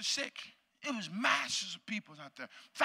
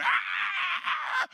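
very high, piercing mock scream; sustained high imitation shriek; imitated woman's scream; 6 dB louder than usual. Lady at the healing prayer, imitated screaming. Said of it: "she started screaming".

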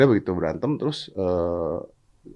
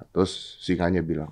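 usual speaking voice

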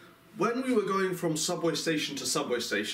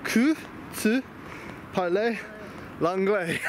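French phrase; 3 semitones higher, slower than usual. Shown as a demonstration. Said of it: "What have we learned?".

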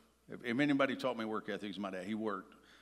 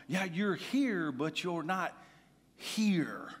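slow, high stress on the final HERE, whispered; wife's pointed words; 7 semitones higher, 4 dB louder, much slower than usual. Retold or imitated. Wife to her distracted husband, stressing "here". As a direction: lean on "here" the second time, breathy and hushed. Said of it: "what Marisa would tell me".

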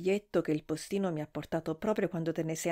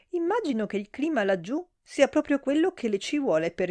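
a fussy lady's voice rising higher and louder in disbelief; flustered matron sputtering out her outrage; scandalised older lady, shrill with shock; shocked gossip; 3 semitones higher, 3 dB louder than usual. Acted. Mrs Worthington chattering to Hosmer.

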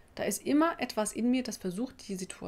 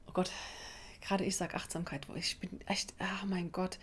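whispered under her breath; embarrassed whisper; 7 dB quieter than usual. Real, not acted. Embarrassed aside about mindfulness.

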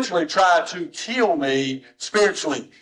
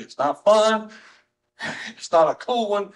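preaching emphasis; 7 semitones higher, 4 dB louder than usual. Real, not acted. Preacher building to a climax.

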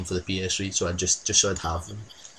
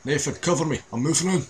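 raised urgent call to teammate; cover me shout; 7 semitones higher, 4 dB louder, faster than usual. Real, not acted. Calling to teammate in game.

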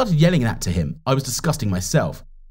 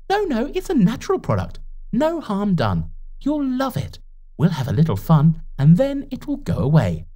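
lightly voiced girl, coaxing sweetly and higher; a hint of a teen girl tempting a friend; Margaret coaxing; 9 semitones higher than usual.

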